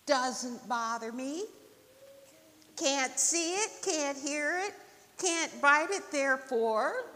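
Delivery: lightly voiced stubborn turtle; dismissive turtle. Turtle denying wind to the rabbit, dismissive.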